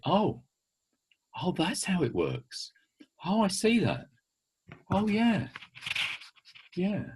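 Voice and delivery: high, soft "oh, I get it" voice; children's dawning realisation. Retold or imitated. Speaker voices children grasping an idea, dawning realisation. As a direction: imitate children gently catching on, pitched well up.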